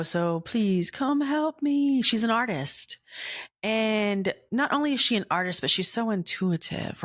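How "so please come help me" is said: pleading. Retold or imitated. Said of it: "And I said".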